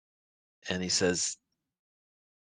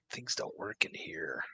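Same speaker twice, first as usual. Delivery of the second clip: whisper; 7 dB quieter than usual. Acted. Player whispers in character.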